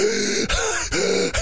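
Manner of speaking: high, heaving gasps for air; imitated wheezing gasps. Smoker running home out of shape, imitated gasping. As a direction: act badly winded from running.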